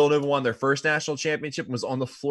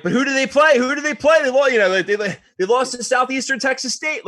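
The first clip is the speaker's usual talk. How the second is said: higher and louder, baffled and worked up; incredulous fan; 10 semitones higher, 5 dB louder than usual. Retold or imitated. A fan puzzling over a small school's record, loud and high. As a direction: rant like a puzzled fan checking a schedule. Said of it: "and you're like".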